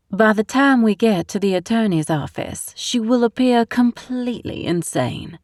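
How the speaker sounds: put-on sweet as syrup, rotten underneath, Southern accent; scheming Southern mother. A narrator's reading. Constance continues plotting.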